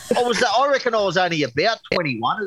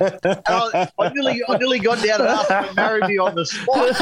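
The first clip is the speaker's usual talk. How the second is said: laughing; 7 semitones higher than usual. Real, not acted. Laughing at own date story.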